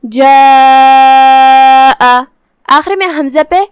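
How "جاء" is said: جاء with the long aa held very long before the hamza; elongated madd. Shown as a demonstration. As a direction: stretch the vowel in جاء for four counts, loud and steady. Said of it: "چار مد کے برابر لمبی کریں گے".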